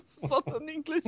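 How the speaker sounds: high, soft laughing echo; laughing repeat. Real, not acted. Host echoes cohost's phrase through laughter.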